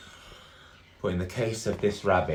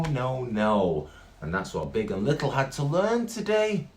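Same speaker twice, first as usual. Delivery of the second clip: ordinary delivery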